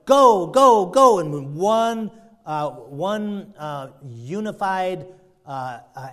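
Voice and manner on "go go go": loud, high cheerleading cry; acted yes-men hyping up the boss; prophets' rousing endorsement. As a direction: egg the king on with gusto. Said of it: "And they're all saying".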